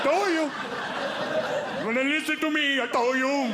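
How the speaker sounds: valet gloating. Retold or imitated. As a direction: crow I-told-you-so at him. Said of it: "the valet's like".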